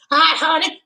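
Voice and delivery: very high mock-sweet greeting; online flirt imitation. Lady greets her online catch, mimicked high flirty voice. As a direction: squeal it like a flirty chatter. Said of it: "That's how they talk".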